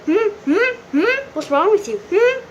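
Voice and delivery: high-pitched, questioning hums; mocking teacher. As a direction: mimic a nosy teacher with squeaky hums. Imitating the teacher questioning him.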